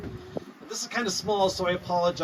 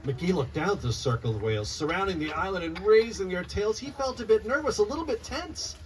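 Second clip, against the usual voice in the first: lively rhyming storybook read-aloud; reading to children; 6 semitones higher than usual. Acted. Author reading her picture book to children, lively read-aloud. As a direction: bounce the rhymes for a room of kids.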